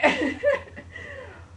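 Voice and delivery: high burst of real laughter. Real, not acted. Teller cracking up while ending the story, real high laugh. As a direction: crack up at the memory.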